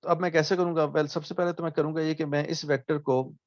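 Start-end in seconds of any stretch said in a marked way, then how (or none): none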